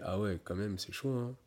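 dejected reaction. Retold or imitated. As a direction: sink into it, glum and beaten. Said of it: "tu fais".

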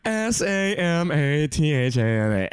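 drawn-out mock broadcaster delivery; hammed-up female announcer; female radio broadcaster. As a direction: spell it out like a radio hostess. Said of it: "female radio broadcaster voice".

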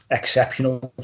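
ordinary talk

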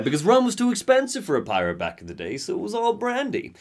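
high, loud, gleeful fun-fact voice; mock excited trivia. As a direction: blurt it like a know-it-all pirate buff. Acts character blurting pirate trivia.

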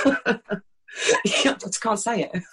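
slow, laughing through the words. Real, not acted. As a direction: giggle it out slowly. Laughing at her own lisp.

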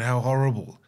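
hammed-up sympathetic voice; imitating gushing pity; a touch of overdone sorrow; mock pity. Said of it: "sit there and go".

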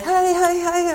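high, nervous "hi, hi, hi". Retold or imitated. Nervous young keeper meeting the coach, high and flustered. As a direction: squeak the greeting like a starstruck kid. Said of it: "all nervous".

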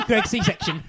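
high, loud, laughing. Real, not acted. Laughing joke name.

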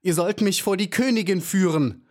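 high-pitched furious insistence, not a full shout; wild, angry demand. A narrator's reading. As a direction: demand it angrily, voice leaping high. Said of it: "fügte so wild und zornig hinzu". (wild und zornig fordernd)

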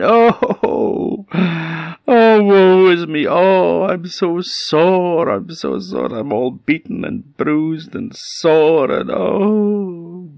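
high, slow hammed-up wail; pained, woeful moaning. Beaten goat moans in the cave, woeful wail. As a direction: wail it long and high, wallowing in soreness.

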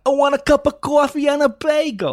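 loud, high, broad New York caricature; New York accent. Acted. Guest joins host ordering food, broad New York accent. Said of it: "doing a New York accent".

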